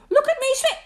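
very high little show-off voice; excited squeak. A narrator's reading. Roo shows off to Kanga, squeaking. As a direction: squeak it proudly. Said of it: "squeaked Roo".

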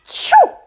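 high loud acted sneeze; a big exaggerated sneeze. King and queen sneeze together, loud high sneeze. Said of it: "sneezed the king and the queen".

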